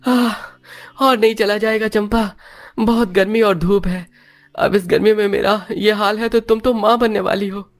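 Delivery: a worn-out man pleading he can't walk further; tired husband in the heat. Acted.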